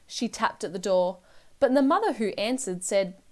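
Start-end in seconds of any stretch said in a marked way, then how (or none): none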